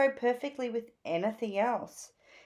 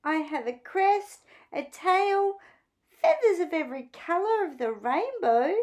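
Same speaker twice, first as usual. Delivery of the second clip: moaning; 10 semitones higher, 3 dB louder than usual. A narrator's reading. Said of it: "moaned Phoebe".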